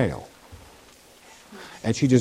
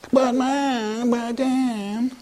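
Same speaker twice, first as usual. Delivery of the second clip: put-on whiny child; 8 semitones higher, much slower than usual. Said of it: "you ever had your kids say".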